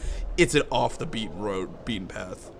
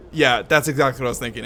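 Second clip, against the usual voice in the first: ordinary talk, nothing marked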